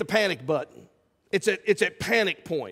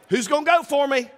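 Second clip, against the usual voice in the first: higher, louder call for volunteers; 7 semitones higher, 4 dB louder than usual. Retold or imitated. The Lord asking for volunteers, calling out. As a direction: call for raised hands. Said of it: "the Lord's saying".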